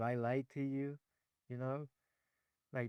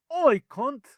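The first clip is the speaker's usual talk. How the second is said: sudden, much higher, much louder "Oi"; mock British shout; 11 semitones higher, 13 dB louder than usual. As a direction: bark it like a rowdy football fan. Mock British lads out of nowhere.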